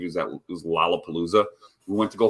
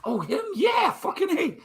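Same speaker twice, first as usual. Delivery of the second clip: mock old-timer. Mocking an old-timer's reaction.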